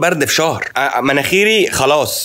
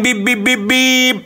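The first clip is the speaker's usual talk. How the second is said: imitated car horn; 10 semitones higher than usual. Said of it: "اللي ورايا عمالين".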